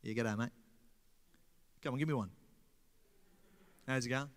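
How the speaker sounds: mild, quiet Australian g'day; Australian greeting. Shown as a demonstration. Showing how Australians say hello, a hint of Australian accent. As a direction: toss off a soft Aussie hello. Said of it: "we do like this".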